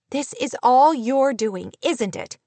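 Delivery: accuse, with a touch of upset; shaking-voiced demand. A narrator's reading. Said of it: "she demanded in a shaking voice".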